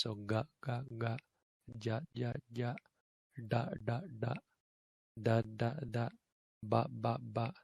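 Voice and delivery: soft "ga, ja, da, ba" with no breath; voiced drill; voiced unaspirated. Shown as a demonstration. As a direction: hum the voice into each. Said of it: "unaspirated but voiced".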